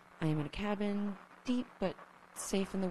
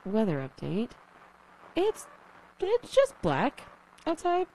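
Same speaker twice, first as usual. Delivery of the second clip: crackly lonely radio dj; 2 semitones higher, 9 dB louder than usual. Acted. Broadcast weather report.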